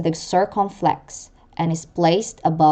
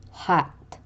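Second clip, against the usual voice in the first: ordinary delivery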